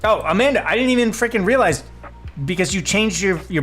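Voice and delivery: high, loud, surprised realisation; surprised recognition. Real, not acted. Streamer realises who is in her chat, surprised. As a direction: burst out on suddenly recognising a friend.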